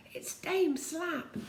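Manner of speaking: high, slow alarmed cry; a hint of panic on the name. A narrator's reading. Moonface warning everyone, high and alarmed.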